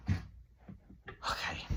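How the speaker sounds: soft unvoiced okay; whispered okay to himself before a tangent. Real, not acted. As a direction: breathe it out as a whispered aside.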